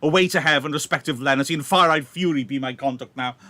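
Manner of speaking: burn with revenge as you speak; fuming. Acted. Romeo fuming as Tybalt returns, loud furious vow. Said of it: "Romeo is fuming".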